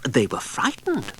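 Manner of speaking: plain reading voice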